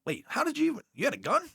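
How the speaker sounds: shocked question pitched high up; retold disbelief. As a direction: act baffled that he even had a gun. Retelling his shocked reaction.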